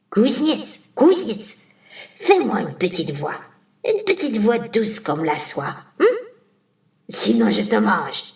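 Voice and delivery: a lightly voiced witch bullying the smith; witch's demand. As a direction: witch ordering the blacksmith.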